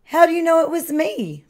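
mild storybook moan from a found-out turkey. A narrator's reading. Turkey asks Cow how he was recognised, mildly moaning. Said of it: "moaned Turkey".

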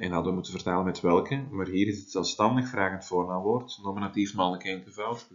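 ordinary talk, nothing marked

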